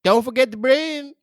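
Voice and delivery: hammed-up sign-off; mock caller hanging up; crude caller. Caller signs off.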